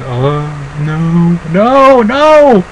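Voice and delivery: drawn-out groan leaping to high wail; hammed-up spectator despair, climbing high; mock dismay. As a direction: moan it, then cry out in exaggerated protest. Acts out dismay at slow match.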